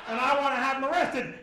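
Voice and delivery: a hint of a stiff official cop, loud. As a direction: play the formal officer, loudly. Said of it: "very cop-like, very".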